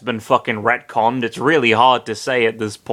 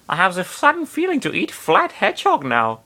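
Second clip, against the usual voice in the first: higher, mock German accent reread; faux German accent; 6 semitones higher than usual. Nana craving hedgehog, in a mock German accent. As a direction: redo it in a fake German accent. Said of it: "faux Teutonic accent".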